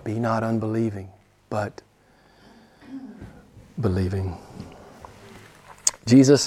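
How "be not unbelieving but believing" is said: low, soft, with pauses before "believing"; hushed repeat. Real, not acted. Preacher presses Thomas's lesson home, hushed. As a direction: lower and soften it, pausing before the last word.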